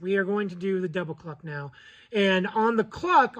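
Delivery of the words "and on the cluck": ordinary talk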